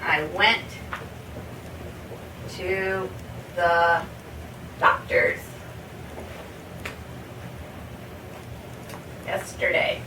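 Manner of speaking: pauses after each word, ending softly; slow word by word. Shown as a demonstration. Teacher modeling the reversed order, slowly. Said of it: "Or you could say".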